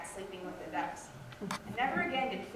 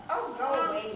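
louder shooing off the ducks; shooing away; 2 semitones higher, 7 dB louder than usual. Acted. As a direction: wave them off with a sharp shoo. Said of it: "waved her hands at them to shoo them away".

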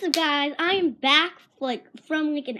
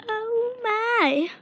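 surprised; 10 semitones higher than usual. Real, not acted. Reacting to candy, repeated.

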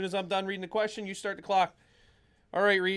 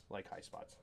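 whispered; 17 dB quieter, slower than usual. Real, not acted. Sponsor plug tossed off aside.

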